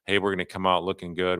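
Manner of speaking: usual speaking voice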